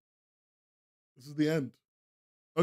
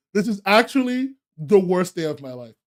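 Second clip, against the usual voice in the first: normal talking voice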